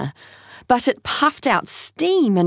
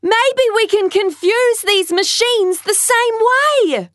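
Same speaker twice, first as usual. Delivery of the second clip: put-on child voice; 13 semitones higher than usual. Tai voiced over noise.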